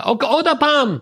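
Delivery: "again!" as a retold shout; imitating a shout. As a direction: bark it out like an impatient teacher. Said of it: "מתחיל לצעוק עליי".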